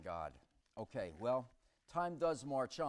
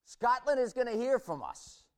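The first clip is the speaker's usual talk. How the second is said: Will Wallace battle cry; 8 semitones higher, 11 dB louder than usual. Retold or imitated. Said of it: "you know, Will Wallace".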